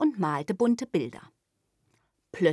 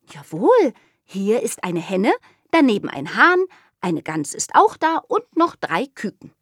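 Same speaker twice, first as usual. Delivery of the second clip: put-on louder, higher proud child; eager little girl; 5 semitones higher, 6 dB louder than usual. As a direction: list the animals brightly. Girl lists farm animals.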